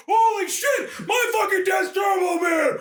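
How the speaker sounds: put-on high, loud gleeful kid yell; excited shout. Kid yelling to his dad in delight, loud and high. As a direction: shriek it like a thrilled kid.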